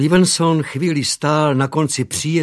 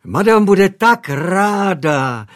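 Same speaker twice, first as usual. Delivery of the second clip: high, slow, louder gushing maid; chattering maid; 7 semitones higher, 7 dB louder, slower than usual. A narrator's reading. Maid chattering to young master, high and gushing. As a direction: coo it out with delight. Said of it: "vykládala dál Elspeth".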